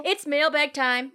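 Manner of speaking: slow, loud show-host announcement; announcer voice. Acted. Announcing the mailbag segment.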